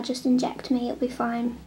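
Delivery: usual speaking voice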